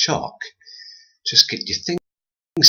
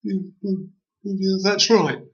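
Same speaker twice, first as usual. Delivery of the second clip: high tree voice; 10 semitones higher, slower than usual. A narrator's reading. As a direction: hum along eagerly. Tree agreeing.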